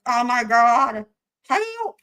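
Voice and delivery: high, helpless squeal; losing it. Real, not acted. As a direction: stretch it out, rising to a squeaked plea. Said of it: "I'm not gonna get through this".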